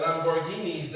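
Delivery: ordinary delivery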